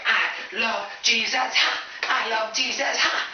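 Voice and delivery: high, slow ecstatic chant with huffed "ha"; hammed-up Southern Baptist fervour; cheering worshippers. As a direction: whoop it like a fired-up congregation. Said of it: "they're all cheering and going nuts".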